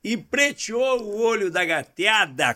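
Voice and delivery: slow, drawn-out catchphrase, a touch of Paulo Santana; imitating Paulo Santana. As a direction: lightly mimic a famous radio commentator, slowly. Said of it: "como dizia o Paulo Santana". (imitando de leve o Paulo Santana, devagar)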